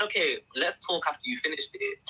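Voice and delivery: normal talking voice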